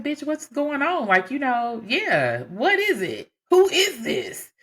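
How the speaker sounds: high, loud startled outburst; panicked "who is this?"; alarmed disbelief. Retold or imitated. Panicked reaction to nurse call.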